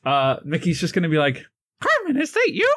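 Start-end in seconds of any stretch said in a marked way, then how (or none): 1.8-2.7 very high cartoon mouse voice; high squeaky mouse greeting an old friend; Mickey voice. Mickey character voice.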